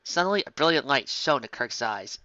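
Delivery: ordinary delivery, nothing marked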